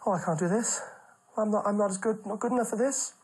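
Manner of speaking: high, rising panic, mock nervous; hammed-up stage fright, voice climbing high; panicky nerves. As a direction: squeak it out like a terrified first-timer. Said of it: "I was that nervous".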